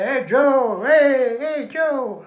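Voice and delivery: mock villagers yelling hey Joe; calling out. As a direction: hail him down the road. Frenchmen calling out to him.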